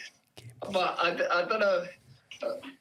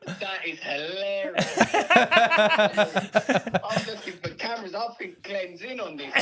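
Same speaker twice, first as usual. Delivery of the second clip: laughing; 3 semitones higher, 5 dB louder than usual. Real, not acted. Realising he was pranked.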